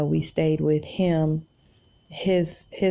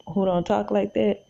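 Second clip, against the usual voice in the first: normal talking voice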